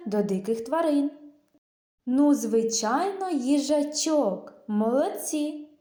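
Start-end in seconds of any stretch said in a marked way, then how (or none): none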